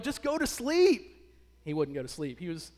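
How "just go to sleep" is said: exasperated. Retold or imitated. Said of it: "We're like".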